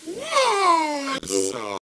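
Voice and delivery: Wausau leaped up high and loud; pitch-adjusted Wausau. Shown as a demonstration. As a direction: sing Wausau way up high in a wail. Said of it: "put a little pitch adjustment to it".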